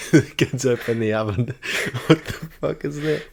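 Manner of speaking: high-pitched, talking through laughter; laughing. Real, not acted. Friend picturing kids finding a burnt elf, laughing hard. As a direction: crack up while telling it.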